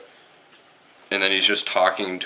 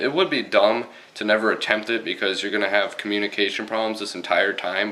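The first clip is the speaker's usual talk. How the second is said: ordinary delivery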